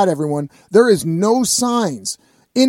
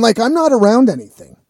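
ordinary talk, nothing marked

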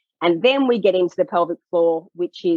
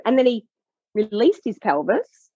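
ordinary talk, nothing marked

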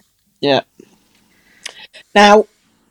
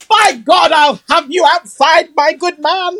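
higher, louder posh English challenge; posh outraged dad; 7 semitones higher, 3 dB louder than usual. Retold or imitated. Posh dad challenges cousin to fight outside, mock outrage. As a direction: bristle like a posh gent offering a fight. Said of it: "quite smartly brought up".

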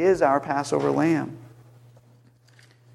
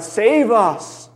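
higher-pitched, mild mock cry; retold cry; 5 semitones higher than usual. Preacher quoting crowds as Jesus entered the city, retold cry. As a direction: call it out as a restrained plea. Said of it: "and they cried".

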